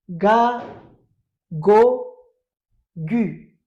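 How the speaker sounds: teacher drilling ga go gu; loud drilling. Shown as a demonstration. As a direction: lead the class through the syllables. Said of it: "Répétons encore".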